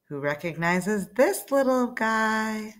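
plain reading voice

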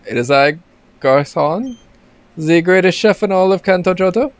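high, hammy French chef voice; bad French accent. Reading the chef's sign in a bad French accent. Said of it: "my French accent is terrible".

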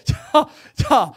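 calling out through laughter; laughing. Real, not acted. Pastor laughing as he calls the room back, loud. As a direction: laugh while restoring order.

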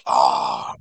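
rough, voiceless "ah"; imitated pained cry. He hurts his hand, imitated rough cry. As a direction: let out a rough mock cry. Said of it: "He goes".

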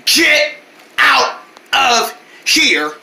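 each word of "get out of here" set apart; very slow, one word at a time with gaps; slow, spelled-out repeat. Acted. Vendors repeating the order to the boys, slow and emphatic. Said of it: "We said".

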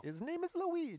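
hammed-up Mario-style Italian; Italian accent. Riffing on Italian jokes, a mock Italian accent. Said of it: "It's the Italian".

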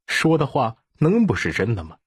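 ordinary delivery, nothing marked

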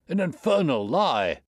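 high, loud, outraged shout; shouting denial. A narrator's reading. Exposed trainer to Holmes, shouted denial. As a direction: hurl it back even harder. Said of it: "he shouted".

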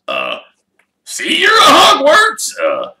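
very high, loud gruff call; calling goodbye. Acted. Hagrid calling goodbye to Harry, loud and high. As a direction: call back as you stride away.

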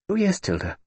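put-on child begging to say prayers with aunt; pleading little girl. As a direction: coax her great-aunt sweetly. Child agreeing.

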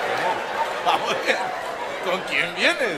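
loud, baffled cross-check; incredulous re-asking. Real, not acted. Incredulous re-asking over laughter.

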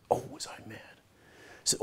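unvoiced hushed whisper, barely there; a secret whisper about being furious. Real, not acted. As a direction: lean in and whisper the anger. Retelling anger at neighbor.

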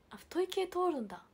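high, loud surprise; surprised. Real, not acted. Streamer is surprised that 太い passes the chat filter, high and loud.

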